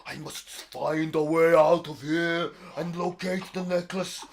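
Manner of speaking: loud, high, slow grand giant voice; excited giant. Acted. Giant realising where the necklace is, loud and high. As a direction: declaim like a giant struck by revelation. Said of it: "And he's like".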